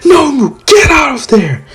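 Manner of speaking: high, loud warning shout; urgent yell. Retold or imitated. Shigaraki yelling at Nomu to flee, high and loud. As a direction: yell at the monster to get away. Villain yells warning to monster.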